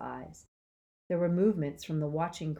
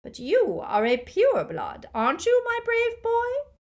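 high, loud, silky dark lord; mock-sweet Voldemort; 7 dB louder than usual. A narrator's reading. Dark lord coaxing Neville, high silky menace. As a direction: flatter him with menace.

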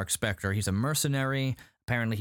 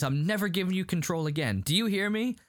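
higher, insistent retold scolding; mock indignant warning; 7 semitones higher than usual. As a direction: act out telling someone off, rising at the end. Acted argument with his reflection.

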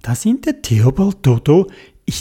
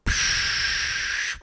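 snorkel hiss; 12 dB quieter than usual. A narrator's reading. Snorkel breath sound.

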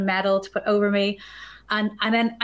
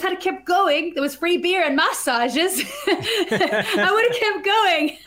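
laughing; 8 semitones higher, slower than usual. Real, not acted. Laughing at the free beer.

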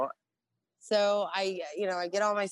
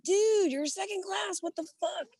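shocked disbelief. Retold or imitated. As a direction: act floored that he outranks you. Surprised to meet old colleague.

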